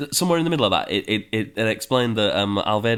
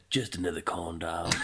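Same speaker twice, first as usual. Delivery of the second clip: devil voice; 3 semitones lower, 9 dB quieter than usual. Pretend. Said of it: "Sexy voice for the devil".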